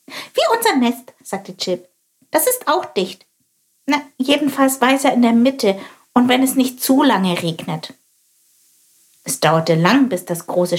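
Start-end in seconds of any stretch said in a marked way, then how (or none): 0.1-1.1 high, curious little bird voice; little sparrow voice. Acted. Chilp compares. 2.3-7.9 high, curious little bird voice; little sparrow voice. Acted. Chilp explains.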